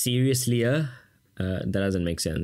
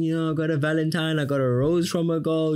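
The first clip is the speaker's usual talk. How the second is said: higher, proud and bragging; boasting boy; 7 semitones higher than usual. Retold or imitated. A boy brags to everyone, pitched higher. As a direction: show off the one Valentine. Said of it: "he's gonna tell everyone".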